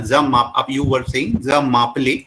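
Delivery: normal talking voice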